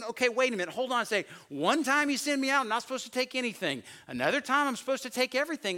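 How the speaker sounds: high, loud baffled protest; confused disciples. Retold or imitated. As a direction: protest like you were just contradicted. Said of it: "Can't you see the disciples going".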